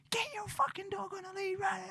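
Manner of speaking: high squeaky mock scream, kept quiet; imitated abusive screaming. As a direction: mimic a hysterical stranger in strained falsetto. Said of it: "Just starts abusing the shit out of".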